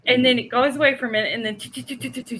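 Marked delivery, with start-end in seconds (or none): 1.6-2.4 soft, rapid run of "choo" sounds; mouth-made rustle of something crashing through branches; imitated branch crashing. Storyteller imitates the rock heading back to the trees, soft crashing sound effect. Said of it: "breaking branches".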